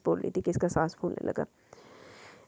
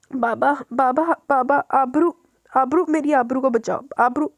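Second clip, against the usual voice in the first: slow, higher delirious cries for his daughter; delirious crying out; 5 semitones higher, 5 dB louder, slower than usual. Acted. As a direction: call out for her in a nightmare. (नींद में बड़बड़ाते हुए बेचैन पुकार)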